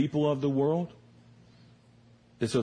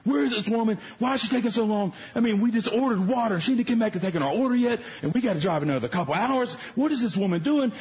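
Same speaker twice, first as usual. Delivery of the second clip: high-pitched, worked-up complaint; angry rant; 10 semitones higher than usual. Retold or imitated. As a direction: retell a fuming rant, pitched way up. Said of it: "just straight up angry".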